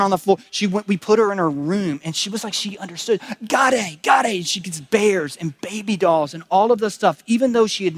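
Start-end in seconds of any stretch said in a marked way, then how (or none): 3.5-4.5 high, imitated squeals of discovery; excited child's cries. Father retelling his daughter's first look at her room, excited cries. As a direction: call it out high like a thrilled toddler. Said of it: "She just got all excited".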